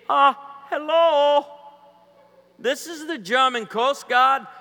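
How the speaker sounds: high, loud German coast guard voice; slow, shouted officious German; mock German accent guard. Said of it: "type in German Coast Guard".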